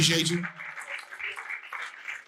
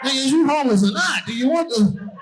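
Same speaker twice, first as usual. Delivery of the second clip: indignant yell; 8 semitones higher than usual. Retold or imitated. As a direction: yell at him for taking a call. Comic yelling at homeless man.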